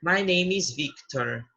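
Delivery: slow English self-introduction, letters drawn out; spelling name slowly. Shown as a demonstration. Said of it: "eu vou pronunciar meu nome, por exemplo". (soletrando o nome bem devagar)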